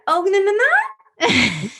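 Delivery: very high, slow, drawn-out whine; whiny mom. Retold or imitated. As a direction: stretch it high like a pleading mom. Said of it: "when we end with that".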